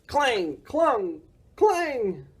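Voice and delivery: rising metallic clang words; clanging sound effect. Acted. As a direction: ring out like garbage lids. Garbage can sounds voiced.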